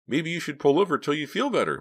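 high-pitched, loud character voice; anxious suggestion. A narrator's reading. Back-seat partner to the sick driver, high anxious suggestion. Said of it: "Mickey suggested".

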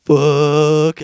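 loud Superman curse; mock grief. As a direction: blurt it out loud and strained. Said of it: "the Superman's like".

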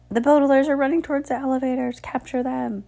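fast, high-pitched alarm; urgent alarm. A narrator's reading. Bearded man alerts the crowd, urgent high warning. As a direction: sound the alarm quickly and high.

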